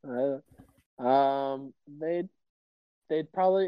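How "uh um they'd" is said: normal talking voice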